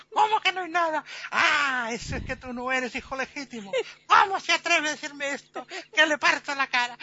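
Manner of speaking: high, heated mock outrage; acted indignant quarrel. Captain and crowd quarrel over legitimacy, high indignant voices. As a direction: answer back as if gravely insulted.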